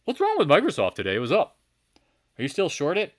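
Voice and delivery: high, loud questioning dad; imitating his dad. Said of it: "if my dad somehow was listening".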